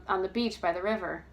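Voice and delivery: usual speaking voice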